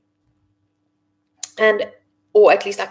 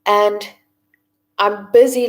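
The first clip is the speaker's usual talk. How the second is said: normal talking voice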